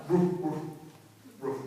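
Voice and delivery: short, low woofs with gaps; imitated barking dog. Neighbor's dog going all night, imitated barking. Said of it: "It's like that dog".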